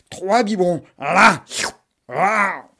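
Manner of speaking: loud, punchy là with each bottle; loud there. Acted. Mother cramming bottles into the monster, loud là.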